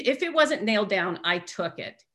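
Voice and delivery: normal talking voice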